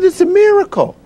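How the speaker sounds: very high, thrilled retold announcement; imitated amazed nurse. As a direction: act out a nurse breaking miraculous news. Voices nurse announcing the good news.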